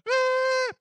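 high, loud long machine beep; imitated monitor beep. Telling the congregation about the ambulance, a high loud beep. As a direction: mimic the flatline tone of the monitor.

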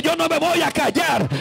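usual speaking voice

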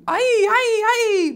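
high crying wail. Acted. Silly sounds page.

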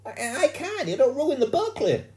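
very high, loud wail of refusal; upset schoolgirl. A narrator's reading. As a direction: wail that it's impossible.

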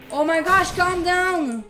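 ordinary delivery

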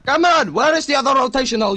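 loud, high frustrated yell; angry yell. Real, not acted. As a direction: shout at a useless teammate. Yelling at teammate in game.

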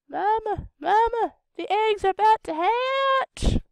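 very high, loud little creature calling mama; squeaky baby voice. Acted. Baby creature calling to mama about the eggs, high and loud. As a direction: squeal it like a hatchling calling its mother.

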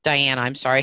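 ordinary talk, nothing marked

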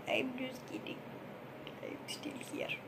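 sneaky whisper. Pretend. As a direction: whisper it like hiding. Whispered after fake leaving.

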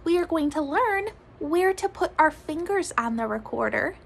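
usual speaking voice

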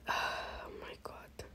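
breathy whispered disbelief; whispering. Real, not acted. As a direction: whisper it in dismay. Whispered after losing.

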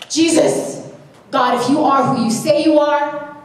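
high, loud pleading cry; desperate cry to God. Retold or imitated. Desperate prayer retold as quote.

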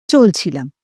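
usual speaking voice

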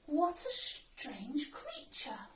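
soft, slow whispered read. A narrator's reading. Teacher reading back the jellyfish's line, whispering. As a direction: keep it hushed, dropping away at the end. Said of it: "whispered a jellyfish".

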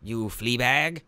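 high, sneering cartoon villain voice; cackling evil overlord taunting his victims; Skeletor impression. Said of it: "Skeletor, the real Skeletor".

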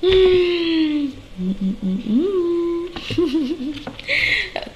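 mock crying whine. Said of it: "cranky, crying".

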